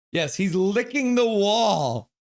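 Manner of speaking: slow, high, loud shout; slow loud incredulous yell; loud exclamation. Real, not acted. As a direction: announce it loudly in disbelief. Shouting about the dog.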